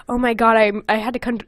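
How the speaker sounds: usual speaking voice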